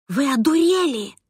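ordinary delivery, nothing marked

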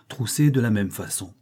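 ordinary delivery, nothing marked

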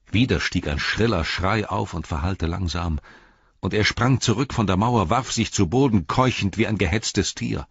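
ordinary delivery, nothing marked

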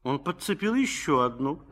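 ordinary talk

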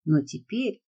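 ordinary delivery

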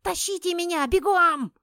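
high, shrill panicked scream; wild yell. A narrator's reading. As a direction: scream for help. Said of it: "раздался дикий вопль чертяки".

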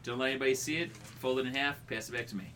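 normal talking voice

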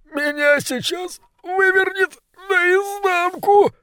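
high, slow, tearful begging; pleading wail. Acted. First ratcatcher begging, sick and terrified, high wail. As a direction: beg through tears, voice cracking up. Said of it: "взмолился крысолов номер один".

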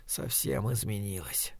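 barely audible, low musing; inward thought. A narrator's reading. Dying man privately repeats that she changed, inward thought. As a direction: trail it softly to yourself. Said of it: "подумал он".

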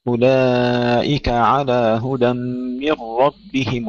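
slow chant, ربهم with a full, heavy ر. Shown as a demonstration. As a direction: chant slowly and thicken the ر of ربهم. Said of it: "وفخم الراء جيدا". (ترتيل بطيء بتفخيم الراء في «ربهم»)

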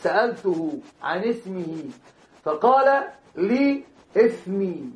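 slow, chanted line of verse; إنشاد بطيء; poem recital. Acted. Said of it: "كما أنشد بعضهم".

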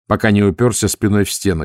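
plain reading voice

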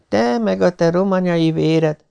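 slow, high-pitched reproach; slow muttered complaint at a partner; grumbled murmur. A narrator's reading. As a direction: grumble it, drawn out.

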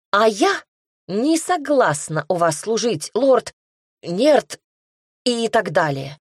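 defiant refusal. Acted. Heroine defiantly refuses lord.

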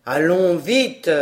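low, slow gruff father; a farmer hurrying his sons; deep paternal command; father ordering. A narrator's reading. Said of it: "interrompus par leur père".

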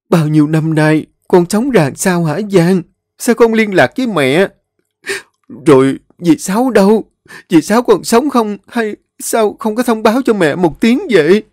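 high, urgent mother's questions; anxious mother. A narrator's reading. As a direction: pour out worried questions. Mother figure voiced. (giọng mẹ cao, lo lắng dồn dập)

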